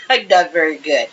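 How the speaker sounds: giggling through the words; laughing. Real, not acted. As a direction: laugh at yourself while admitting it. Laughing at herself.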